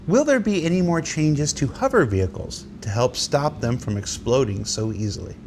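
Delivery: usual speaking voice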